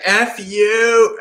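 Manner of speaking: high, loud angry outburst; mock anger. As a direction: bark it out in fake rage. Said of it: "And then anger".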